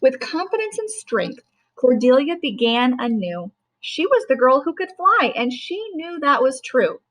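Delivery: put-on measured read-aloud voice for a child; a parent reading a rhyming story aloud; storybook narration. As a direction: read it like a bedtime picture book. Mother reads picture book aloud.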